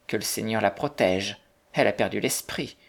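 usual speaking voice

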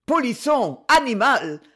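put-on furious general cursing the boy; angry outburst. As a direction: bark the insults at the boy. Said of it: "s'écria-t-il".

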